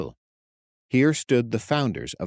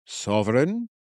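expectant; 3 semitones higher than usual. A narrator's reading. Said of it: "Ward said expectantly".

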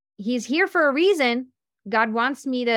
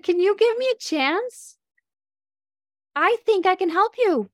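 eager pitch; 9 semitones higher, 4 dB louder than usual. Retold or imitated. Pitching herself to a prospect.

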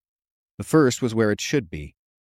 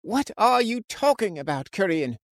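high-pitched yell at normal volume; exasperated shout; 11 semitones higher than usual. A narrator's reading. Frightened man yelling at his best friend, exasperated. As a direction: yell it in disbelief, pitch leaping way up. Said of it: "he shouted".

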